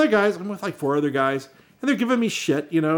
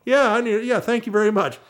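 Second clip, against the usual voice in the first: higher, easygoing hippie voice; a laid-back stoner offering a ride; the hippie driver pulls up to a hitchhiking teen, mellow and higher-pitched; hippie van driver; 7 semitones higher than usual. Retold or imitated. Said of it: "It's like".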